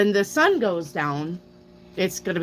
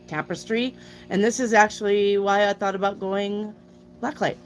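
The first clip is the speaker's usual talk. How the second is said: ordinary talk, nothing marked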